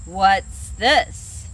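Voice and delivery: big brown bear questioning the animals; frowning bear. Acted. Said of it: "says the big brown bear with a frown".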